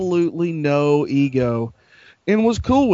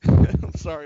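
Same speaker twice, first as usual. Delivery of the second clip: laughing at own joke before the punchline; breathless laugh, then a sorry; cracking up mid-line; 6 dB louder than usual. Real, not acted. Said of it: "I'm laughing at my own stupid joke".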